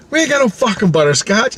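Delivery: high, exasperated mock rant; acted-out irate ice cream man; swearing vendor. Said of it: "he's swearing at me".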